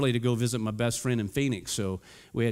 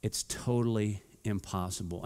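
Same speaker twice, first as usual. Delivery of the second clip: softer, lower, slow disbelief; hushed, slow no way; doubting; 5 semitones lower, 5 dB quieter, slower than usual. Retold or imitated. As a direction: mutter the doubt to yourself. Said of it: "you're gonna think".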